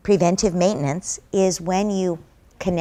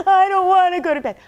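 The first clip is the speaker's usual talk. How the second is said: put-on higher, louder child's protest; whiny toddler; 9 semitones higher, 6 dB louder than usual. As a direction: play the two-year-old refusing bed. Toddler refusing bedtime.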